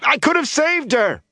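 high, desperate cry; pleading shout to someone leaving; calling after her. A narrator's reading. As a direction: call out in anguish. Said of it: "Aram called after her".

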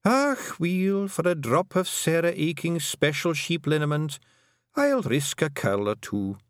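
put-on cheeky old Scots, high on Ach; old Scottish Kelda. A narrator's reading. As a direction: brush off the warning with relish.

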